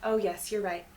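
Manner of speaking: ordinary talk, nothing marked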